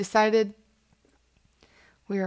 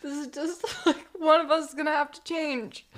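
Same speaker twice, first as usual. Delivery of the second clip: laughing; 6 semitones higher than usual. Real, not acted. Silly hot dog vs taco bit.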